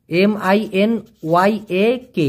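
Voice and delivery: M I N Y A K spelled out slowly, one letter at a time; spelling letter by letter. Shown as a demonstration. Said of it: "আছে হচ্ছে মিয়া". (ধীরে ধীরে এক এক করে বানান)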